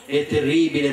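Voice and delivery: ordinary delivery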